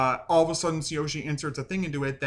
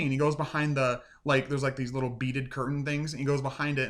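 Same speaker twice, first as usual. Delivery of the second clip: ordinary talk, nothing marked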